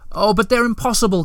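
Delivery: high, pleading cry of alarm; desperate cry. A narrator's reading. Moomintroll begs the Hemulan not to go, high desperate cry. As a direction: cry out, beside yourself. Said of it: "cried Moomintroll, beside himself now".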